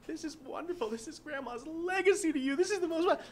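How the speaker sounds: high, teary mock mom; mom crying. Mom on the phone, high and mock tearful. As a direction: imitate mom weeping with joy. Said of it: "She starts crying".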